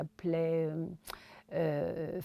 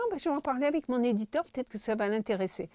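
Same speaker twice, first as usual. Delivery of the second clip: quick, higher chirpy promise; fast high voice; 6 semitones higher, much faster than usual. Retold or imitated. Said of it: "Elle avait une voix un peu aiguë". (voix aiguë pressée)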